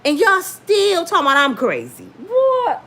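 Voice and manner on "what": high, sharp what; shocked disbelief; incredulous. Real, not acted. Reacting to being called crazy.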